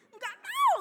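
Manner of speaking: very high, loud comic squeal; exaggerated high-pitched clueless reply; mock bewildered cry. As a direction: squeal it out as a hammed-up retort. Bewildered answer in retold exchange.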